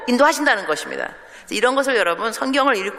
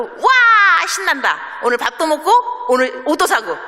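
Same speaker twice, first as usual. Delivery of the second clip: put-on higher and louder, gleeful kid cheer; mock thrilled daughter; 7 semitones higher, 4 dB louder than usual. A daughter tells her mom she is thrilled about the meal and new clothes.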